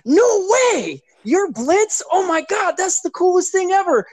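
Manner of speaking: very high-pitched mock amazement; thrilled fan imitation. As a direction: imitate fans gushing at meeting their mascot. Retelling a couple recognizing the mascot.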